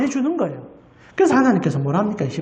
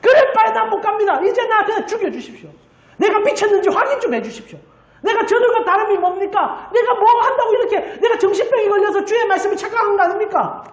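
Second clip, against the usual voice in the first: crying out; 8 semitones higher than usual. Retold or imitated. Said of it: "울부짖고 이제 번아웃돼서 이제 펑펑 하나님 앞에 이제 소리치는 거예요".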